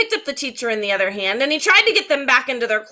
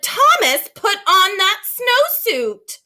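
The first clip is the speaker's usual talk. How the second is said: much higher, slow and loud scolding; exasperated teacher; 7 semitones higher, 5 dB louder, slower than usual. Acted. Teacher scolding a stubborn boy, high, slow and exasperated. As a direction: spell out each word, losing patience. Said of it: "jumped up and down and said".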